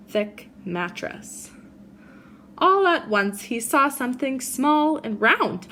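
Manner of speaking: put-on gentle read-aloud for children; reading a story to a class; storybook reading. A narrator's reading. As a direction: tell it like a bedtime picture book. Reads picture book to kids.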